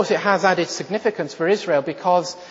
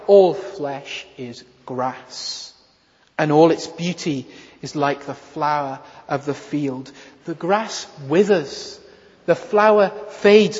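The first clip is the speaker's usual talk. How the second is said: very slow, weighty, drawn-out reading; solemn scripture reading; 3 semitones lower than usual. Acted. Preacher reading Isaiah to the congregation, slow and solemn. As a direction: let each word of the prophecy sink in.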